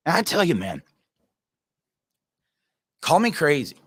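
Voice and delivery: normal talking voice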